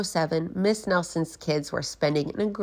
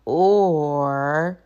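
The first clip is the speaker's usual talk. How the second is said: menacing teacher; 6 dB louder than usual. Acted. Threatening teacher.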